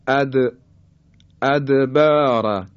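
"ادبار" with د released in a small bounce; qalqalah on د. Shown as a demonstration. Teacher models the letter dal in "ادبار", bouncing qalqalah. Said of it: "حرف دال".

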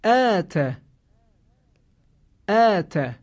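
آت with a thin, light long آ after hamza; correct thin aa. Shown as a demonstration. Teacher modelling آت with thin aa. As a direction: say آت with a thin, small aa sound. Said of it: "خوب توجه کنید".

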